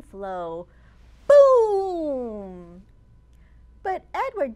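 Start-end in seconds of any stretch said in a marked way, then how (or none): 1.3-2.8 loud booming sound word; big boom. A narrator's reading. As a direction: blast it out like an explosion.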